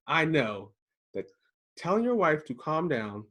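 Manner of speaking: usual speaking voice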